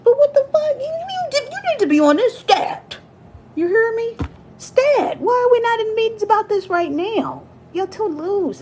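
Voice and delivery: high, loud mock panic; mock alarmed boss. Imitating a boss reacting to the security warning, loud high panic. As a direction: shriek like a boss demanding it stat. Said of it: "it's like".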